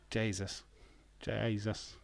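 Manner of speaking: normal talking voice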